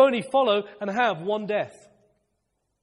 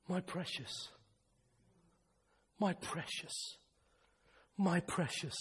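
whispered tenderness; 7 semitones lower, 17 dB quieter, slower than usual. Real, not acted.

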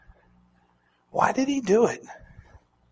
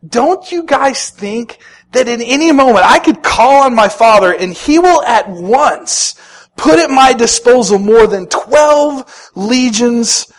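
raised, louder challenge to the crowd; emphatic Jesus; 6 semitones higher, 7 dB louder than usual. Retold or imitated. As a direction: press it like an indignant question. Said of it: "he's looking at these people and he says".

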